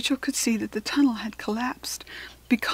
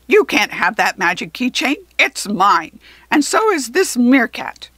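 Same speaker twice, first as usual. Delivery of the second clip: loud, possessive goblin outburst; shouting goblin; 7 dB louder than usual. A narrator's reading. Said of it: "he shouted".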